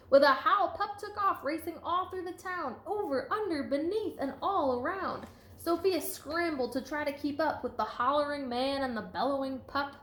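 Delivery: lively, sing-song storybook read; reading to children. A narrator's reading. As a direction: read it out to a class with bounce. Teacher reading picture book aloud.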